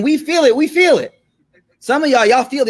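congregation-stirring call, pitch climbing. Retold or imitated.

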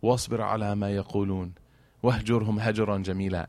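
quietly chanted verse in Arabic; soft Quranic recitation. Acted. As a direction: recite the verse softly and reverently.

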